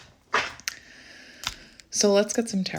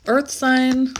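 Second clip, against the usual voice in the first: higher, excited laughing; 7 semitones higher, 3 dB louder than usual. Real, not acted. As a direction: laugh at the coincidence. Another match.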